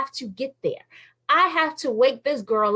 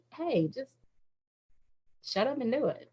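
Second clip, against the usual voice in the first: soft mock command; 9 dB quieter than usual. Voicing God, softly.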